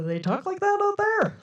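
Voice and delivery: mock incredulous, climbing very high; high squeaked question of disbelief; imitated disbelief. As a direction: imitate a stranger who can't believe that accent. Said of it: "they go".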